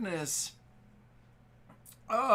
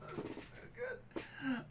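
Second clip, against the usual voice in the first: teary; 12 semitones higher, 10 dB quieter, slower than usual. Real, not acted. Streamer near tears of laughter.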